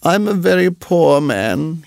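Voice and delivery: high, slow tearful lament; sobbing. A narrator's reading. Muskrat in tears before Rikki Tikki, sobbing. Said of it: "he sobbed".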